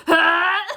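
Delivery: loud laughing yelp of disgust; disgusted yell. Retold or imitated. Reacting to sister's gross advice, laughing yelp. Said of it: "I went".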